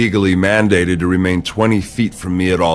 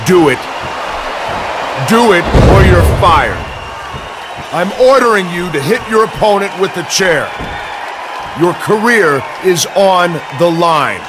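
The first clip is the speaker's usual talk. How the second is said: shouted threat; 8 semitones higher than usual. Acted. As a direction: shout it as an ultimatum over the crowd. Shouted threat of firing to force obedience.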